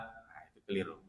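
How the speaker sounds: ordinary talk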